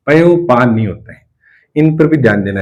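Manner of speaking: ordinary talk, nothing marked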